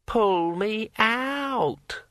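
very high, drawn-out ringing voice; like a wet finger on a wine glass; ringing metallic sword voice. A narrator's reading. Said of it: "repeated Kring".

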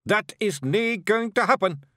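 sudden fierce shout. Acted. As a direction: snap it out so the boy backs away. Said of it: "shouted Rob Anybody".

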